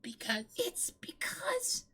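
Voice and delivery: very high breathy whisper, slow; Pikachu whisper. Acted. Pikachu answering Ash in a high whisper. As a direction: whisper like a tiny creature. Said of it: "when Pikachu talks".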